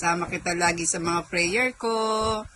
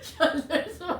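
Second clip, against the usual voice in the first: laughing; 7 semitones higher, 4 dB quieter than usual. Real, not acted. Laughing during exercise.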